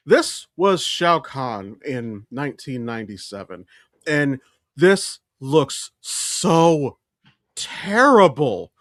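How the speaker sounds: ordinary delivery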